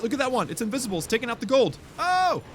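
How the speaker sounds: thrilled game commentary; excited. Real, not acted. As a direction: gush over the invisible goblins. Goblins steal gold excitedly.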